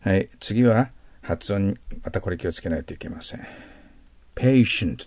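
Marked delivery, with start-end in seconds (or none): 4.4-5.0 patient said slowly as PAY-shent; pronunciation demo. Shown as a demonstration. Teacher showing students how to say patient. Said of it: "patientと発音します".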